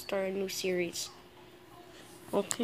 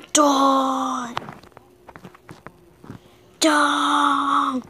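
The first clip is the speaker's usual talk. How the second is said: loud, higher yelled command; shouting; 5 semitones higher, 9 dB louder than usual. Real, not acted. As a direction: yell it at the enemy. Yelling at enemy in game.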